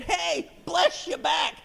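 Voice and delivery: high, drawn-out happy shout; hearty returned greeting. Acted. Workers used to the boss's blessing, high hearty shout. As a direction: shout it back gladly to the boss.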